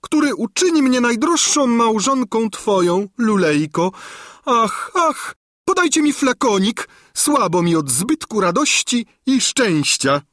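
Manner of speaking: high, loud, fainting gush; swooning old woman. A narrator's reading. Old governess swoons before the king, high and gushing. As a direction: flutter and nearly faint with joy.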